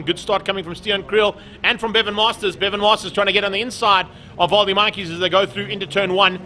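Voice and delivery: loud, excited race-start call; punchy motorsport play-by-play at full energy; live race commentary. Real, not acted. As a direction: call the start with rising urgency.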